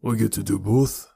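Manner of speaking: throaty thug with a Scottish lilt; deep, throaty Scottish. A narrator's reading. Pinky explains his tattoo, throaty Scottish voice. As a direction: speak from the throat with a hint of Scottish. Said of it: "His voice was deep and throaty".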